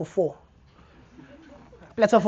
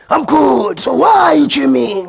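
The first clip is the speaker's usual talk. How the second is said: high, loud mock gym bro; gym guy asking; 7 semitones higher, 11 dB louder, slower than usual. Gym regular asking why he is gymming, high and loud. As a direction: press him like a pushy gym regular.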